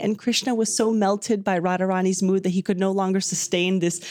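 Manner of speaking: ordinary delivery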